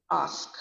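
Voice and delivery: "Ask" as AHSK from the throat; long AH vowel. Shown as a demonstration. Teacher demonstrating ask for learners, long throaty AH. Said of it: "sound is coming from the throat".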